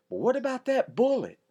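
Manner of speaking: put-on high, loud kid question; eager little boy; curious boy. Ben asking.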